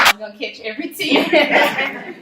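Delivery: tearful laugh, high and loud. Real, not acted. Candidate thanking the photographer, laughing loudly. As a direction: laugh through the emotion.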